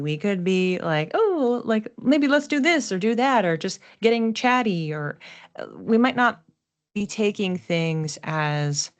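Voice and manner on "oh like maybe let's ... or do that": airy, scattered and higher, bouncing between ideas; flighty. Retold or imitated. Imitating a flighty Gemini Mars, mildly higher and airy. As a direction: toss off plans like a chatty, flighty Gemini Mars. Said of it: "We could be flighty".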